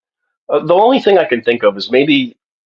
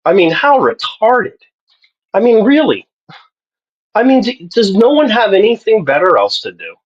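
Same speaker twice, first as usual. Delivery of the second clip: exasperated; 11 semitones higher than usual. Real, not acted. Ranting about elders banning games.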